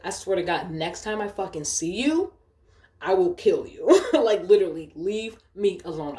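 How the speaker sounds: ordinary delivery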